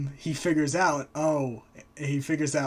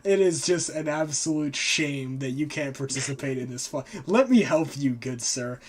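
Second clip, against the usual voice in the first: mock sympathetic Loki; 4 dB louder than usual. Trickster to blind Hod, loud mock pity. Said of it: "and Loki's like".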